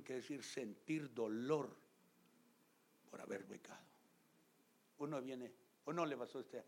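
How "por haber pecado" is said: whisper. Acted.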